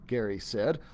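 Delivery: usual speaking voice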